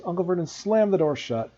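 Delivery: ordinary delivery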